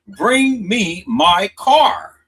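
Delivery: loud and slow, leaning hard on Bring me my car; emphatic loud quote. Shown as a demonstration. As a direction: slam out the exact words as proof. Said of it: "her exact words was".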